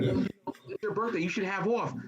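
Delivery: slow, high, mock-sympathetic lady voice; drawn-out high voice of a clueless tourist; imitating the lady. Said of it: "and she's like".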